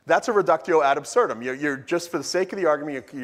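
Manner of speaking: ordinary delivery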